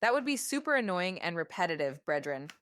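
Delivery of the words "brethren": ordinary delivery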